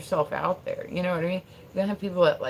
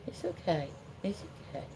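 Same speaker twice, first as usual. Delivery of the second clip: soft whisper, almost no voice; whispered soothing; 11 dB quieter than usual. Real, not acted. Streamer whispering to her dog running in his sleep. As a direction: hush a dreaming dog.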